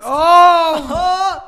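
loud, high shocked cry; shocked shout. Real, not acted. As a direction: blurt it out in disgust.

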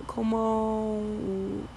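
very soft, clipped Andalusian "¿Cómo?"; southern Spanish accent. Retold or imitated. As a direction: toss off a quiet southern Spain "¿Cómo?". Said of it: "how they say gracia".

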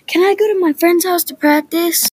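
put-on high, loud kid's yell. A narrator's reading. Child yelling to ask permission to visit a friend. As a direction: call out a request at full volume. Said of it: "so I yell".

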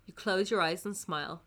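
ordinary talk, nothing marked

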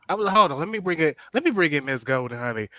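ordinary talk, nothing marked

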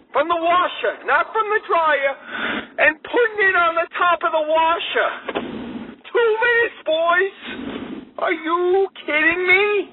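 high-pitched, exasperated rant; worked-up rant. Real, not acted. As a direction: sputter with disbelief. Ranting about laundry incident.

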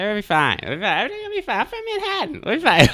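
very high, exaggerated New York accent; mock Manhattan accent. Mocking the seatmate's Manhattan accent, squeaky high. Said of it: "the accent".

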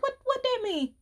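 high, indignant mock question; mock offended. To daddy refusing a kiss at work, high mock offense. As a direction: act offended, pitched high. Arguing with dad.

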